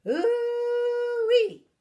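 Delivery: very high, loud whoop, then slow; grandma whooping as she takes charge; Grandma's whoop. Acted. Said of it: "Grandma said".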